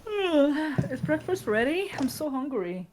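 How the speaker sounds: put-on high, loud groggy child voice; sleepy boy. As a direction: whine it like a just-woken kid. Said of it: "rubbing his eyes and yawning, going".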